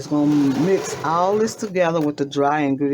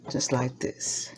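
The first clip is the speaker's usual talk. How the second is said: whispered aside; 4 semitones lower, 7 dB quieter, slower than usual. Real, not acted. Showing the batter as she stirs.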